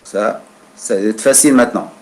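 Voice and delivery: normal talking voice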